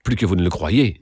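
plain reading voice

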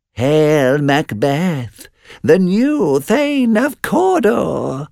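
very high, drawn-out witch cry; second witch crying out. A narrator's reading. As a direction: wail the greeting slowly and very high. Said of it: "cried the second witch".

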